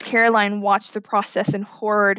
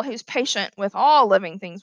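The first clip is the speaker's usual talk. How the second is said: ordinary delivery, nothing marked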